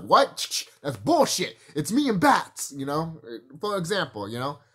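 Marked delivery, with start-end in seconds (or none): 0.0-2.7 higher, louder outburst of disbelief; indignant mock protest; indignant Joker. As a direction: blurt it like an outraged comic-book villain. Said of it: "And Joker was like".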